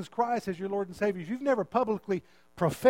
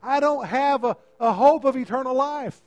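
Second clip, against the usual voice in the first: desperate cry to God; 5 semitones higher, 7 dB louder than usual. Acted. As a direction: pour out helplessness at full voice. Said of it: "Will you cry out".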